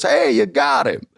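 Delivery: high, lightly voiced Texan mentor, casual; Texas boy. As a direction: toss it off as a breezy Texas good ol' boy. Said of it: "good old Texas boy".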